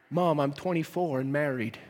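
low, tired grown son; exasperated son. Retold or imitated. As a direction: sigh it out, a bit fed up, laughing. Son answering his mother.

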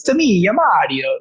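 put-on a quick game-style Italian accent; Mario Italian accent. Recalling video games, playful mock Italian accent. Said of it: "that was what I was channeling".

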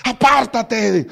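loud, slow, drawn-out command; sharp rebuke. Retold or imitated. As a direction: cast him off in one forceful word. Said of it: "la reacción de él es inmediata". (grito lento y fuerte de reproche)

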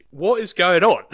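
loud, high-pitched disbelief; baffled, exasperated outburst; exasperated bafflement. Acted. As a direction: cry out in mock bewilderment. Acts out baffled viewer reaction.

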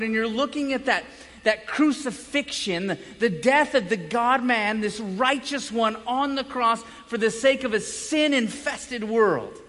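ordinary talk, nothing marked

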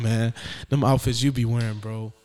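ordinary delivery

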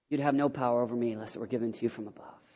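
low, soft, quick reply; understated, hushed rebuke; Jesus. Acted. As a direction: answer the threat with quiet authority. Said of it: "Looks at him and goes".

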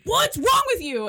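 hammed-up hurt little brother; mock upset. Brother confronting Francis.

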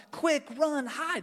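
put-on high, frantic child's call to flee; panicked kid. Son fearing grandpa's anger, high urgent call to hide. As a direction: squeal it like a scared little boy. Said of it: "because he goes".

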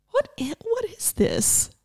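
high, soft, bewildered; puzzled wonder. Retold or imitated. Waking up to a strange new feeling, high and puzzled. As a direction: marvel at something strange. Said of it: "just so strange".

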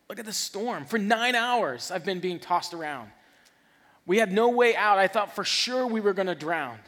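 loud, high-pitched outburst in bursts; exasperated protest. Acted. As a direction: argue back as if the danger were obvious. Acts Peter protesting his doubt.

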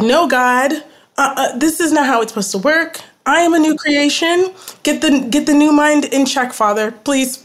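reliving a desperate plea, half laughing at herself; retold frantic prayer. Recalling her panicked prayer to God, retold frantic pleading.

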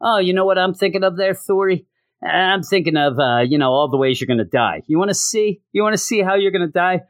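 high, goading retold taunt. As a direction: needle him with a teasing, singled-out threat. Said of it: "seem to kind of taunt Thor".

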